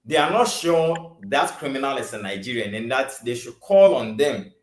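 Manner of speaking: ordinary talk, nothing marked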